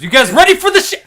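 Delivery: high, fast, shouted hype. Pretend. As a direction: yell it like a hype man. Mock hype announcement.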